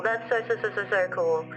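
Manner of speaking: ordinary delivery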